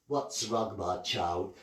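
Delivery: low, soft kindly voice; hushed motherly fairy voice; gentle fairy godmother. Acted. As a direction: soothe the child like a fairy godmother. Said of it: "stood there and said".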